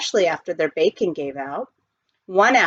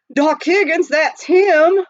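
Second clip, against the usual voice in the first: gravelly miner; 10 semitones higher, 7 dB louder, slower than usual. A narrator's reading. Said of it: "as if he had a mouthful of gravel".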